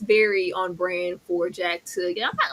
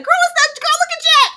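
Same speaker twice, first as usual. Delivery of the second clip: high, loud gushing; 8 dB louder than usual. Retold or imitated. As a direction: shriek with delight at Jack. Excited gushing quote.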